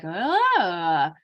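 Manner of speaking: a short rising sung "Ah"; jargon sound. Shown as a demonstration. Therapist demonstrating a child's unintelligible jargon, rising tune. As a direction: model a child's wordless jargon with a lilt. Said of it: "They might be like".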